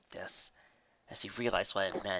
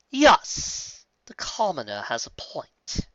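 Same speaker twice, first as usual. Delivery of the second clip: sly dark queen; 6 semitones higher, 7 dB louder, much slower than usual. A narrator's reading.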